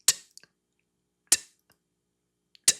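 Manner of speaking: a quick, clipped "t" with the tongue tapping; short t sound. Shown as a demonstration. Teacher showing children the letter sound, short tapped t. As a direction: tap out "t" short and light. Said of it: "the sound is short and quick".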